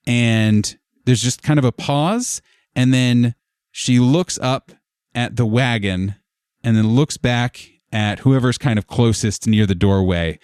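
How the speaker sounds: normal talking voice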